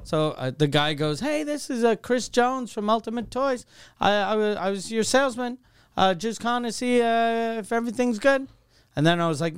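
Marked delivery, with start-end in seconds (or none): 1.2-8.5 yüksek perdeden satıcı taklidi; chirpy salesman. Retold or imitated. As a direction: imitate a salesman's high-pitched courtesy call. Acting the salesman's phone call.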